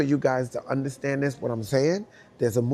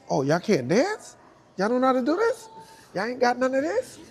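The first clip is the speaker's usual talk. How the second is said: high, loud taunting brag; high taunting trash talk, getting louder; 12 semitones higher, 7 dB louder than usual. Retold or imitated. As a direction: taunt the crew with mock pity. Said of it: "I'm talking shit now".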